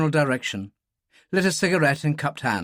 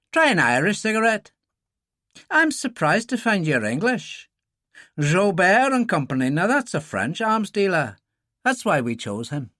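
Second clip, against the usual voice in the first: put-on higher-pitched young Irishman sizing up a stranger; Irish gunman Daly; 7 semitones higher than usual. A narrator's reading. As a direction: play Daly, probing the English visitor.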